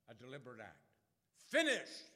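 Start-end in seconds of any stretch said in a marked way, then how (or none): 1.4-2.1 very loud, high cry; full-throated cry. Acted. Preacher shouting Jesus' cry to the congregation. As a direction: let it ring out at full force.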